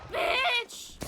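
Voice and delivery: a high, loud snap at the enemy; angry yell. Real, not acted. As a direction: yell it at the shooter. Yelled in a firefight.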